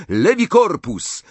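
high, loud cry of a spell; shouted spell. A narrator's reading. Boy casting a spell on reflex, shouting. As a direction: fire the incantation out. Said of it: "krzyknął".

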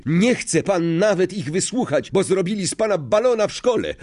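high-pitched protest, speeding up; indignant cry. A narrator's reading. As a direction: fling the accusation in outrage. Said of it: "krzyknął Harry". (oburzony okrzyk, coraz szybciej)

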